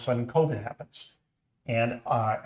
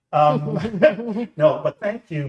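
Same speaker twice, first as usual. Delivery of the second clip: emotional laugh; 3 semitones higher, 8 dB louder than usual. Real, not acted. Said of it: "I'm gonna get emotional".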